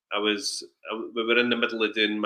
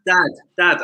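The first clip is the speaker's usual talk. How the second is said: high, louder calls for dad; child calling; 7 semitones higher, 6 dB louder than usual. Retold or imitated. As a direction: pester like a small boy. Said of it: "he's like".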